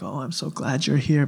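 soft, sugary put-on greeting; fake welcome. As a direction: gush politely but insincerely. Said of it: "do the hugging thing".

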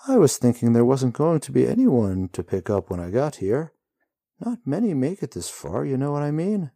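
high breezy rider chatting to the hero; lightly voiced cheery rider. A narrator's reading. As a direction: play the chatty rider at ease. Rider's voice in story.

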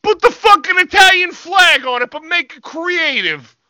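high, yelled meathead voice; Vito. Retold or imitated. Wrestler Vito ordering his gear, high mock shout. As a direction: bark the order like a dumb tough guy. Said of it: "And he goes".

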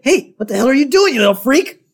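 high-pitched mock outburst, fast; acted indignant snap, pitched right up. Mo to the kid who squirted him, mock-angry outburst. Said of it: "And Mo's like".

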